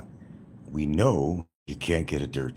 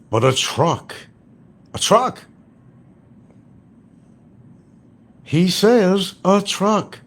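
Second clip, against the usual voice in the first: truck said high and loud, drawn out; mocking emphasis; 14 semitones higher, 7 dB louder, slower than usual. Ridiculing his claim, stressing truck high and loud. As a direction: hammer the word truck in disbelief.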